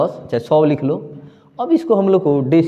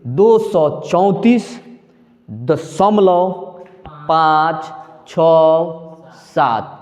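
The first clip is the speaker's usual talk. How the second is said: दशमलव के बाद बहुत धीमे, एक-एक अंक; "पाँच छः सात" खींचकर अलग-अलग बोलो; slow model reading; much slower than usual. Shown as a demonstration. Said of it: "दाहिने साइड रहे उसको एक साथ पढ़ना है". (दशमलव के बाद अंक धीरे-धीरे, अलग-अलग)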